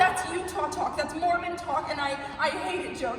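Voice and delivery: furious shout. Acted. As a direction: scream the accusation at him. Wife screams at husband.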